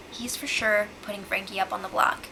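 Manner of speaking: hushed secret-plan voice; conspiratorial whisper. Real, not acted.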